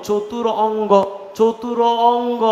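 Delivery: চতুর অঙ্গ said slowly as two separate parts; split into parts. Shown as a demonstration. Teacher splitting the word for the class, slow and broken apart. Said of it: "তো ভাইয়া, চতুরঙ্গ".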